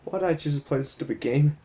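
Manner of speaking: normal talking voice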